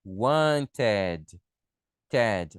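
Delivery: "wanted" in two beats, "wan-TED"; -ed as full syllable. Shown as a demonstration. Teacher likening it to Ted the bear, "wanted" as "wan-ted". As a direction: make the end of "wanted" rhyme with "Ted". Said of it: "lembra do ursinho Ted?".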